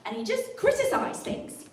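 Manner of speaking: high-pitched British caricature; British accent demo. Shown as a demonstration. Comic mocking British speakers for the audience, British demo. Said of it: "how to do a great British accent".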